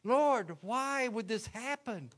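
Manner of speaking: high, loud, slow anguished cry to the Lord; devastated plea. Retold or imitated. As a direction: wail it out in despair, slow and high. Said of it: "I was devastated".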